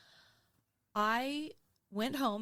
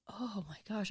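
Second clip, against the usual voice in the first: whisper; 5 dB quieter than usual. Retold or imitated. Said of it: "And I woke up and I was like".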